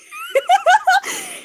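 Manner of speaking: high, loud burst of laughter; high laughing fit. Real, not acted. Laughing at the British joke.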